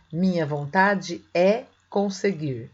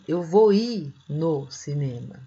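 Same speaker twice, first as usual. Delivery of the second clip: slow wrong example; 3 semitones lower, slower than usual. Shown as a demonstration. Teacher to students, slowly showing a common mistake. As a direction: slowly present the error. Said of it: "quando alguém fala assim".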